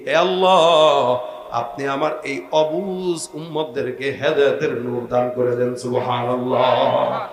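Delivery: low, drawn-out sermon chant; melodic preaching. Acted. The Prophet prays for his people's guidance, melodic preaching. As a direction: let the phrase endings stretch out in prayer.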